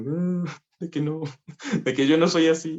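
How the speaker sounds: high, whiny, half-laughing denial; mock whiny protest. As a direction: whine it like a hurt kid, mockingly. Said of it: "yo lloraba en el fondo".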